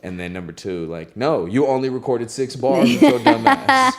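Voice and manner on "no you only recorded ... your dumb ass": loud, high retold telling-off; mock scolding. Friends correcting the loaded artist, mock scolding. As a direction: raise the voice to imitate friends setting someone straight. Acted retort of friends defending him.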